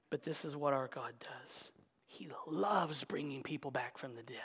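hushed, intimate whisper; preacher whispering an urgent aside to the congregation. Real, not acted. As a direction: lean in and whisper it.

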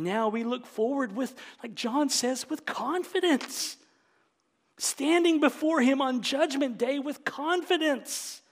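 high, loud, ringing proclamation; triumphant preaching. Real, not acted. Preacher assuring believers about Judgment Day, triumphant. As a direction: proclaim it boldly, each word set apart.

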